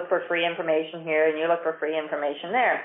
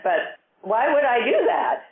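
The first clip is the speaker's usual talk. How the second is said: bewildered; 6 semitones higher than usual. Retold or imitated. Bewildered lady questions retail trader.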